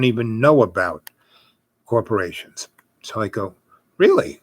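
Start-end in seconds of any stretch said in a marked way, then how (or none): 4.0-4.4 surprised. Retold or imitated. His surprise at the corporation.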